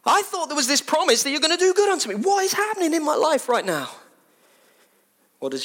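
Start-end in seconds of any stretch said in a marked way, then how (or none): 0.0-3.9 high, hurt, begging voice; wounded plea to Jesus; pleading disciples. Acted. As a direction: plead like a betrayed child. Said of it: "I'm just putting us in it".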